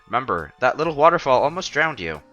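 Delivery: ordinary talk, nothing marked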